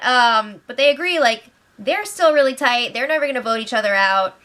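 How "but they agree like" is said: ordinary delivery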